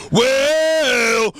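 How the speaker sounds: long-held words climbing to a high bellow; mock entrance music. Pitching a tag team's entrance music, mock bellow. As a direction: belt each word like clashing theme songs. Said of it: "the entrance music will be".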